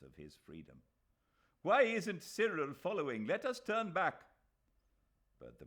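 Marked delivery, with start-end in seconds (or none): 1.6-4.3 high, loud plea to turn; urgent call. A narrator's reading. Aziz begging the carriage to turn back, loud urgent call. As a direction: demand they go back for him.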